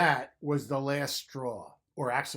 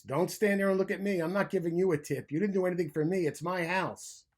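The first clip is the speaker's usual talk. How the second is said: louder, higher grumpy telling-off; grumpy scolding; 4 semitones higher, 5 dB louder than usual. A narrator's reading. Bruce scolds the goose bellhops in a loud, higher grumpy voice. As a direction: scold them like a cranky bear.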